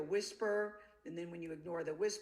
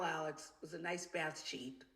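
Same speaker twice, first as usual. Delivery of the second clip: usual speaking voice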